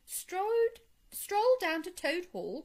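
high, incredulous echo question; shocked, high-pitched query; astonished cry. A narrator's reading. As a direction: repeat it in disbelief, pitch shooting up. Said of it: "cried the Rat".

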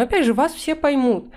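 normal talking voice

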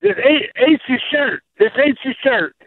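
usual speaking voice

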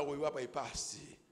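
usual speaking voice

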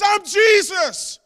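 loud, high, slow proclamation. Acted. Preacher proclaiming Jesus' reply to Saul, loud and slow. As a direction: declare the name with full force.

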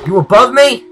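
loud, high challenge; calling out. Real, not acted. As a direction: shout out for a hidden enemy. Hunting the noisy mob, calling out.

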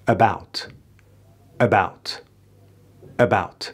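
"About" with a light, unstressed "uh" at the start; barely touch the first vowel of "About"; unstressed schwa. Shown as a demonstration. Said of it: "این صدا استرسی نداره".

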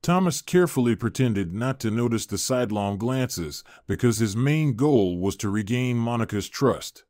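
ordinary delivery, nothing marked

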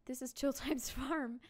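slow name reading broken by a laugh; amused and cracking up. Real, not acted. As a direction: giggle through the silly name. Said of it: "I'm gonna laugh every time".

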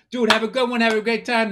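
lightly mimicked pre-show send-off; Paul Verzi. Comic mimicking a friend getting ready for a show, light impression. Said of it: "Paul Verzi getting ready for a show".

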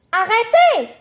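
high, loud shout; cried-out protest. A narrator's reading. Catherine grabbed by the hair, high shouted protest. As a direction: shout it out to stop them. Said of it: "s'écrie Catherine".

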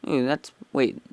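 usual speaking voice

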